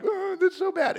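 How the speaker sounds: high, sing-song whiny moan; mock whining. Preacher mocks the Israelites complaining to God, whiny and high. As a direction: whine it like a spoiled complainer. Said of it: "God hears them whining".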